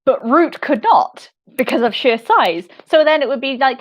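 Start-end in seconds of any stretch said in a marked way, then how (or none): none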